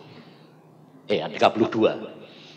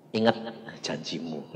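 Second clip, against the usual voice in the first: soft, low "Ingat janjimu"; hushed, low "janjimu"; quiet reminder; 11 semitones lower, 9 dB quieter than usual. Acted. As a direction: murmur it like a voice in the heart.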